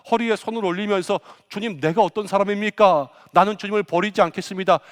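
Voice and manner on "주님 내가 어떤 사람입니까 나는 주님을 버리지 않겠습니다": loud, proud vow with hands on hips; boastful shout. Retold or imitated. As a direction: shout it out like a cocky disciple. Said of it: "이렇게 소리쳤던 사람입니다".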